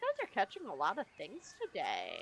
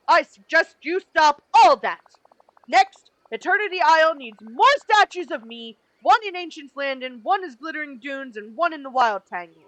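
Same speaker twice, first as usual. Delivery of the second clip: loud boastful brute voice; pompous Gaston; 4 semitones higher, 14 dB louder than usual. Acted. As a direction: boast like a vain hunter. Voices Gaston character dialogue.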